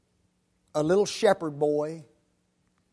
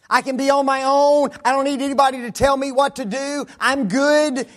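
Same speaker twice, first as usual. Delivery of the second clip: put-on high, loud brushing-off young voice; mock defiant youth; 9 semitones higher, 7 dB louder than usual. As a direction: toss off help like a know-it-all kid.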